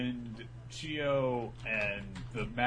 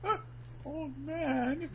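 high, indignant mock squeak; hammed-up high offended voice; 5 dB quieter, slower than usual. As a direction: squeal it, insulted. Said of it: "He's like".

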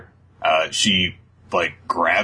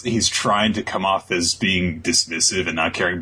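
ordinary talk, nothing marked

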